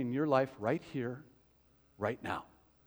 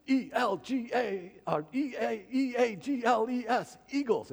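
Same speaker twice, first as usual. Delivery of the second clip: high-pitched chanted spelling cheer; Philly fan cheer; 5 semitones higher than usual. Acted. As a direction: lead the stadium in a botched cheer. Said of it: "ready for a cheer".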